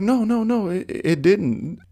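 high, soft, halting denial; lightly voiced high-pitched clerk; imitated clerk. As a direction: put on a high, quiet no-no-no. Mimics clerk's denial in story.

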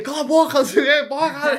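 mock angry shout. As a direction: shout him down in play-acted rage. Player yells back in quarrel. (grito de raiva encenado, meio rindo)

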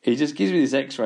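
ordinary talk, nothing marked

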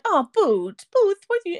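high, sing-song pet talk; cat voice. Retold or imitated. Talking sweetly to her cats, high pet voice. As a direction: coo at the cats like a baby. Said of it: "do you have a cat voice".

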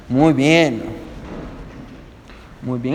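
ordinary talk

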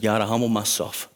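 a humble prayer on bended knee, soft and low. Pretend. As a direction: pray it quietly on your knees. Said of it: "got on your knees and just said".

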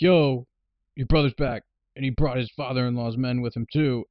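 loud, urgent heads-up; hammed-up messenger shout; mock call-out. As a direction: burst in with the news.

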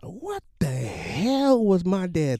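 high, loud, drawn-out disbelief; incredulous outburst. Retold or imitated. Son seeing the dented car, incredulous. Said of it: "here's my response".

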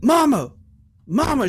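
high, imitated drunk holler; drunken yelling. Drunk son calling through mom's door, high holler. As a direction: yell for mom like a drunk son. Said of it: "beat on her door drunk and high as shit".

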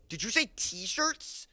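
high, slow, stunned asking; awed goblin question. Acted. Goblin turns to the kid, slow high astonishment. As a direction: stretch it out in wonder.

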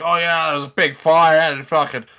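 put-on Australian storyteller; Australian accent. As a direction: tell it like an Aussie local. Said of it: "And they're like".

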